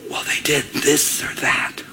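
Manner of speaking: gossipy whisper. Acted. Gossip passing on a rumor to a friend, whispered. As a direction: whisper the juicy secret slowly. Acted gossip shares secret.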